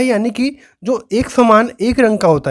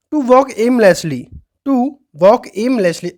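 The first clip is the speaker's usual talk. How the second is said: slow dictation; much slower than usual. Shown as a demonstration. Teacher dictates the definition slowly.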